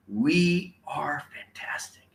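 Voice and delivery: slow, measured cheer line; drawn-out model phrase. Shown as a demonstration. Speaker models a phrase for audience, slow and measured. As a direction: stretch out each word slowly. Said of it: "Say it nice and loud".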